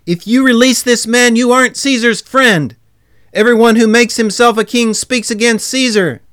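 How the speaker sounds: loud, high threatening shout; crying out. A narrator's reading. Crowd pressures Pilate as he seeks release, shouting. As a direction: shout it as a warning from the mob.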